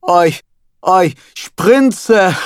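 dubbing the rabbi close to climax; building mock moan. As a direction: ham it up toward a climax. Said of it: "The rabbi was about to climax".

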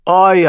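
dubbing a rabbi's pleasure moan; mock moan. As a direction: ham up a porn moan. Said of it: "I moaned".

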